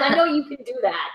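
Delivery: normal talking voice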